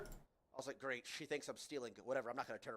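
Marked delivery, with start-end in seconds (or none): none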